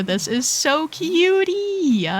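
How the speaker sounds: cutesy squeal. Real, not acted. Cutesy drawn-out exclamation.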